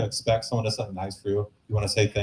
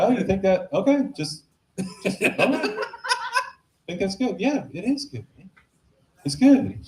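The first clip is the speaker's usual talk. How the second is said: higher, sing-song mocking girlfriend; 8 semitones higher than usual. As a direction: play her as a teasing girlfriend. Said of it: "So now she's like".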